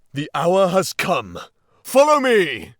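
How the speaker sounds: loud, high charging shout; rally the charge, rising to a roar on Follow me; battle cry. A narrator's reading. Badger leading the charge into the hall, loud battle cry. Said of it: "and cried".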